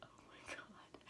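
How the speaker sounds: tiny whispered aside; stunned whisper. Retold or imitated. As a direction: whisper it under your breath in disbelief. Reacting to the grandma's threats.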